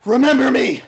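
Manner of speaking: high, loud, drawn-out proclamation; heroic farewell cry. Acted. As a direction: shout a grand, hammed-up last stand. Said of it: "tell them".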